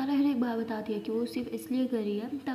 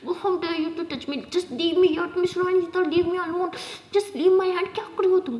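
higher, rising angry protest; protesting angrily; 6 semitones higher, 3 dB louder than usual. Acted. Sara protesting as Jess picks her up, loud. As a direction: fight her off, getting louder.

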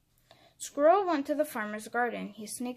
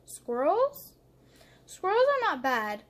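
higher, louder playful bear; teasing bear; 6 semitones higher, 5 dB louder than usual. Acted. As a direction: tease the squirrel with a sly answer. Bear teasingly answering the squirrel.

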